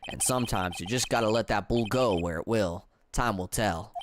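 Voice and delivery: deep-set Southern twang; Southern accent. Acted. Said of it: "stop me from doing this damn accent".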